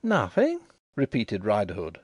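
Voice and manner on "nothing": sharp high outraged question; indignant amazement. A narrator's reading. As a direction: throw the word back in disbelief. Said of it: "indignantly and amazedly".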